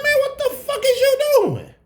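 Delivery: very high, shocked shout; screaming in disbelief at his friend; shocked yell. Retold or imitated. Catching Warren with the dealer, a high shocked shout.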